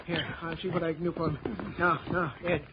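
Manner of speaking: soft, calm bedside reassurance; quiet the hurt boy gently; soothing doctor. Acted. Doctor tends the injured boy.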